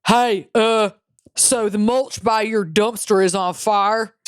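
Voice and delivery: imitated Southern drawl, slow and drawn out; southern accent. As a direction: play a slow-talking Southern customer. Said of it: "this guy with a southern accent goes".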